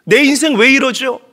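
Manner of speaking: loud, high wailing plea to God; crying out to God. Acted. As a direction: cry out in desperate anguish, half out of her mind. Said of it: "하나님께 소리내어서 우는 거예요". (하나님께 울부짖는 절박한 외침)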